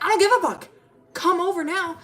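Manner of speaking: put-on loud, high drunken demand; drunk yelling. Drunk friend shouting over speakerphone, loud and high. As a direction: yell it like a wasted friend on the phone.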